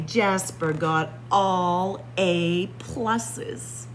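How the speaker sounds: plain reading voice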